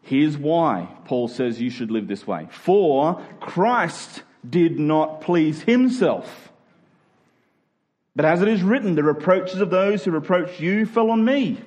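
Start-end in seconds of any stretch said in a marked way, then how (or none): none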